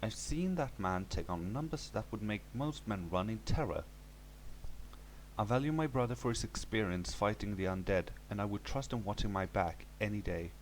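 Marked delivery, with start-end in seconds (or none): none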